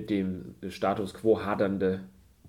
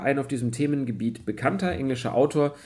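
usual speaking voice

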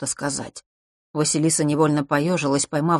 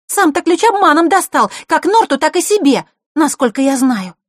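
put-on very high, loud girlish retort; indignant outburst; 12 semitones higher, 8 dB louder than usual. A narrator's reading. Girl can bear it no longer, indignant outburst. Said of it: "не выдержала девочка".

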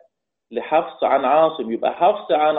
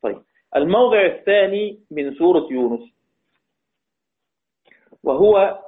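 normal talking voice

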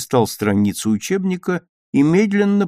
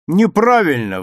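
loud, higher interjection; sudden objection; 5 semitones higher, 7 dB louder than usual. A narrator's reading. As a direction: cut in loudly with a correction. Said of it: "вдруг послышался голос Пети". (внезапное громкое возражение)

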